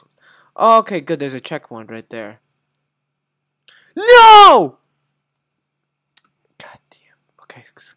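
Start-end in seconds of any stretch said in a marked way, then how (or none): 4.0-4.8 high, loud scream of NO; frustrated scream. Real, not acted. Gamer failing the jump, frustrated scream. Fails in rage game. 6.6-7.9 barely audible, voiceless whisper; whispered cursing. Real, not acted. Gamer regrouping at the checkpoint, whispered cursing. Muttering after failure.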